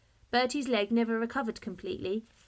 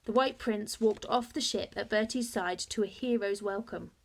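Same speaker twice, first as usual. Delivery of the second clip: ordinary delivery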